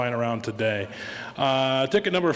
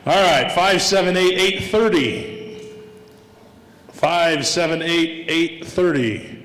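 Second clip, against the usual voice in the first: bingo caller; 3 semitones higher, 6 dB louder than usual. Real, not acted. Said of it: "I feel like a bingo caller".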